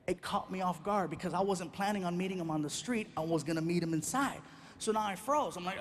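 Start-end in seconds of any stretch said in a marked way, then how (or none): none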